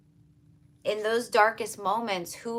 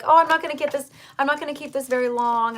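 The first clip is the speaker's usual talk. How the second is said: higher, louder breezy self-quote; quoting herself; 6 semitones higher, 8 dB louder, faster than usual. Retold or imitated. Reader recalls doubting the reading would last, higher and louder. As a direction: toss off your own earlier thought, higher and louder. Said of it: "And I was like".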